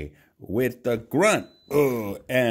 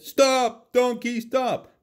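a higher mock shout; shouting after donkey; 13 semitones higher than usual. A narrator's reading. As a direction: call the runaway back. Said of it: "the farmer and his son shouted".

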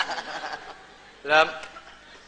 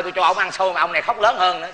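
ordinary delivery

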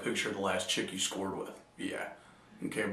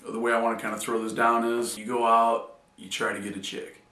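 ordinary delivery

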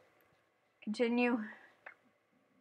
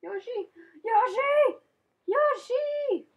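calling out; 11 semitones higher, slower than usual. Real, not acted. Calling the lost Yoshi.

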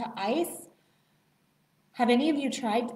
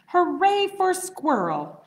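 shouted; 7 semitones higher, 5 dB louder, slower than usual. A narrator's reading. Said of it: "shouted all the animals".

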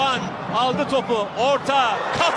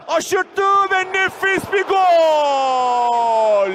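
very slow, stretched goal yell; screaming football commentator; much slower than usual. Real, not acted. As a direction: drag every word out. Goal call.

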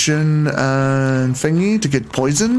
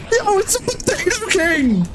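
high excited squeal; 14 semitones higher than usual. Real, not acted. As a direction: announce the find, delighted and high. Excited discovery.

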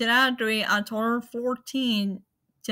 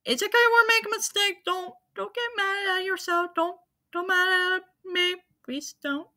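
much higher, fast mock-chat voice; squeaky reply voice; 9 semitones higher than usual. Streamer voicing his own chat, high squeaky reply. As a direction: squeak it back as the forgiving viewers.

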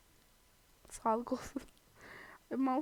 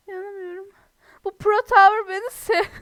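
shocked gasp; 7 semitones higher, 12 dB louder than usual. Real, not acted. Shocked at game.

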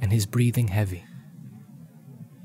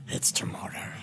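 whispered Gollum; 8 dB quieter than usual. Acted. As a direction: sly whisper.

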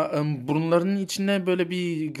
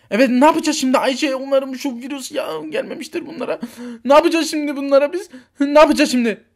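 higher-pitched, flustered questioning; panicking; 5 semitones higher, 3 dB louder than usual. Acted. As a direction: fret helplessly, asking over and over. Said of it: "kendin panik yapıyorsun". (telaşlı, panik içinde)

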